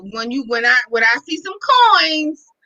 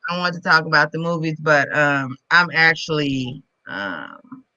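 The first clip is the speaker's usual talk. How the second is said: low, slow, weary voice; tired; 8 semitones lower, 4 dB quieter than usual. Real, not acted. Guest telling hosts why she is leaving early, tired. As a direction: drag the words out, drained and ready for bed. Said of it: "kinda tired today".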